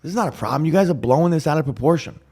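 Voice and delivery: high-pitched indignant protest; defensive. Retold or imitated. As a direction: push back as if under attack. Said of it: "I was defensive. I put my guard up".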